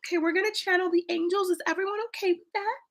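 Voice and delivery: lightly mock a hesitant host seeking permission; mock timid asking. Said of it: "I'm not like".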